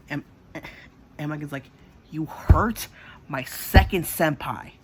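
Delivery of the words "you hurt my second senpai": loud, higher acted fury; re-enacted angry shout; pissed. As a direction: hit it hard and drag out the accusation. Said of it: "She was pissed".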